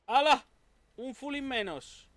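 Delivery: loud high whoop of triumph; triumphant shout. Real, not acted. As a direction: cheer a kill. Kills an enemy.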